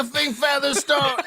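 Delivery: high-pitched mock French yelling; French-accented yell. Blues GM cursing his own defenseman, yelling in a French accent. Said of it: "in a French accent, he's yelling".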